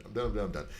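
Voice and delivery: usual speaking voice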